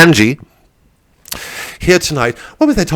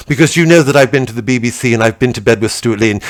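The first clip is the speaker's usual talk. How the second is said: usual speaking voice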